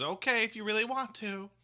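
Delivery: high, mock-reluctant wife voice; imitating wife. Husband mimicking his wife agreeing late at night, high mocking imitation. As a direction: pitch it way up to mimic your wife. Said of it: "That's not how I sound".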